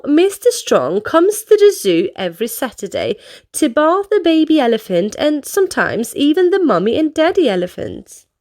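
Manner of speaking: plain reading voice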